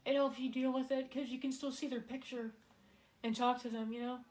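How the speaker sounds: real grief kept in check; emotional. Real, not acted. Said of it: "I'm trying not to get emotional".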